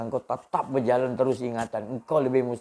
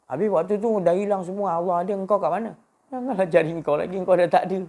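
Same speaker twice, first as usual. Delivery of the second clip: rising, loud preacher's climax; preaching at full force; 8 semitones higher, 5 dB louder, faster than usual. Acted. Preacher stirring the congregation, loud and high. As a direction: build the fervour higher and louder.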